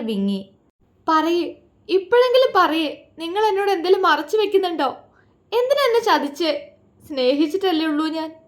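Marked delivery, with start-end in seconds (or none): 1.1-8.3 high, fast sobbing plea; betrayed wife sobbing at her husband, distraught; bursting into tears. A narrator's reading. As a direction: cry out the betrayal. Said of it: "Mitra പൊട്ടിക്കരഞ്ഞുകൊണ്ട് ചോദിച്ചു".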